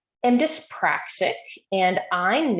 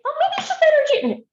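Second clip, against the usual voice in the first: high, loud and very fast; breezy reassurance; 5 dB louder, much faster than usual. Retold or imitated. Therapist brightly dismissing child's worry, high and quick. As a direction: toss it off brightly to cheer him.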